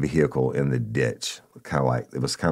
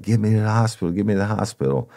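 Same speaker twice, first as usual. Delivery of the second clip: panicked plea; 8 semitones higher, much faster than usual. Retold or imitated. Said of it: "he's screaming, he's crying".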